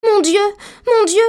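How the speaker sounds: high, loud exclamation of dismay; dismayed cry. A narrator's reading. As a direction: cry out in consternation as a distraught little girl. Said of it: "s'écria Dorothée en joignant les mains, consternée". (cri consterné, aigu et fort)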